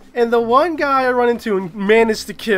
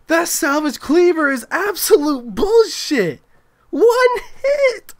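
outraged disbelief; 8 semitones higher than usual. Real, not acted. A player rants after one hit kills him, outraged. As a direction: rant in disbelief at the weapon.

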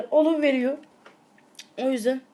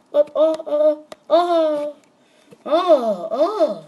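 panicked cries; 3 semitones higher, 7 dB louder, much slower than usual. Real, not acted. In-game panic.

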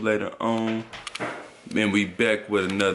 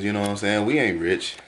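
ordinary talk, nothing marked

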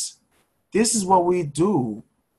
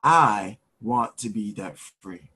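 slow, low, measured affirmation; lead the affirmation; 8 semitones lower, much slower than usual. Shown as a demonstration. Coach leading listeners to say it along, slow and deliberate. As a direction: spell it out slowly for others to repeat. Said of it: "I want you to say this with me".

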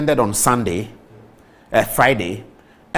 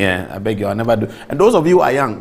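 ordinary delivery

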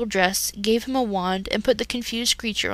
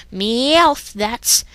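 cat villain; 3 semitones higher, 5 dB louder than usual. Acted. Meowth's motto line.

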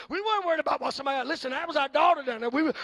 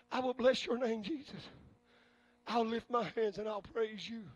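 quiet prayer; 2 semitones lower, 7 dB quieter than usual. Acted. Preacher praying quietly to God before the congregation. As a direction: pray it softly over the music.

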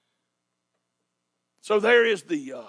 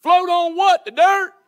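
loud, high sarcastic jeer; mocking disbelief; 5 semitones higher, 6 dB louder, slower than usual. Mocker ridicules the boat.

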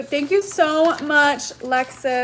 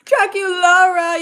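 higher and louder, gushing over the name; delighted squeal; 7 semitones higher, 6 dB louder than usual. Real, not acted. Spotting a favourite character sticker, a delighted louder squeal.

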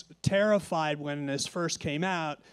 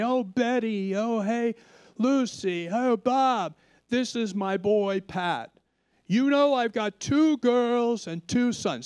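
proud neighbor introducing; 5 semitones higher, 3 dB louder than usual. Retold or imitated. Friend introduces him door to door.

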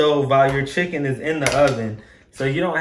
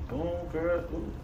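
normal talking voice

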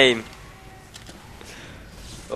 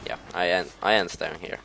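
ordinary talk

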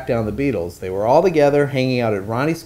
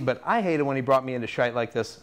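ordinary delivery, nothing marked

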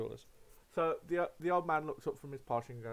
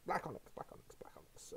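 muttering to himself; 3 semitones higher, 11 dB quieter than usual. Acted. Shopkeeper muttering while scrolling.

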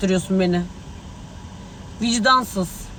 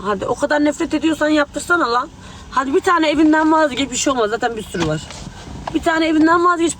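angry dare; 5 semitones higher than usual. Real, not acted. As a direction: throw the dare in his face. Angry dare on a live stream. (öfkeyle, bağırarak meydan okuma)

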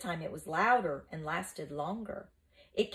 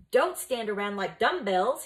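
louder, impatient scolding call; urgent shout; 3 semitones higher, 6 dB louder than usual. Acted. Mucius to the stunned boys, shouting at them. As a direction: snap the others out of their daze, loudly. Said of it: "he shouted at the others".

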